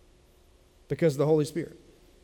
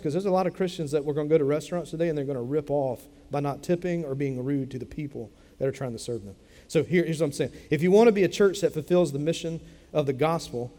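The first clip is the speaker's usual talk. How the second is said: normal talking voice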